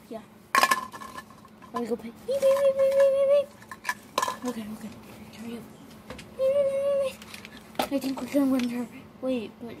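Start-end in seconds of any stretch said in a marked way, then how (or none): 2.3-4.5 high, loud imitated register beeping; scanner beeps. Checkout scanner in pretend shop. 6.4-7.9 scanner beeps. Pretend. Checkout scanner in pretend shop.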